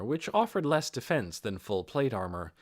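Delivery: ordinary delivery, nothing marked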